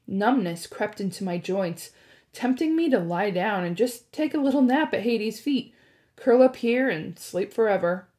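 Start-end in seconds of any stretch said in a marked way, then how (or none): none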